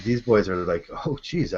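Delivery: usual speaking voice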